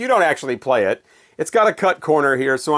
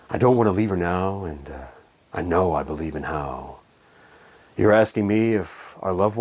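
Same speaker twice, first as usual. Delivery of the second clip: very low, soft spoken-word lyrics; 11 semitones lower, 6 dB quieter than usual. Retold or imitated. Reviewer imitates the singer talking the next verse, low talk-singing. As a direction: talk through the verse like a laid-back crooner, pausing between lines. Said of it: "start talking again as the next verse happens".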